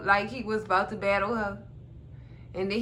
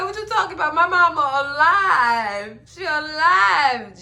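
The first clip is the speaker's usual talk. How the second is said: indignant denial; 4 semitones higher, 7 dB louder than usual. Retold or imitated. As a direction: insist she is alive, mock indignant. Son retold denying mother's state.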